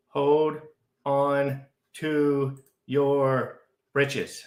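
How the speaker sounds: hold, then stretch the rest out slowly; drawn-out warning. Real, not acted. To the chat as Bitcoin spikes, drawn-out warning. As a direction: draw out every word like a warning. Streamer repeats warning slower.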